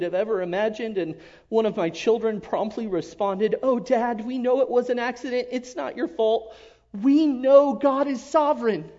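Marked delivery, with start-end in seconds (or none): 3.6-8.9 lightly voiced child, higher and louder; earnest kid comforting father; child reassuring dad. Father retelling his child's reassurance, lightly voiced and higher.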